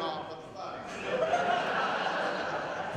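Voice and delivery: very high, slow, breathy laughing gasp; two committee ladies gasping, appalled; mock shocked gasp. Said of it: "they both went".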